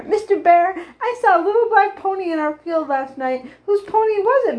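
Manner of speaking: high, eager burst of news; excited report. A narrator's reading. Girl rushes to tell news.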